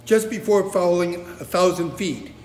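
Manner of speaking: ordinary delivery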